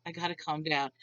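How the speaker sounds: low, soft voice, calming yourself down; muted self-talk. Retold or imitated. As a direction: talk yourself down under your breath.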